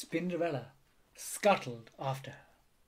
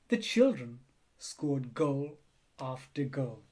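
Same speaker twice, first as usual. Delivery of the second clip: ordinary delivery, nothing marked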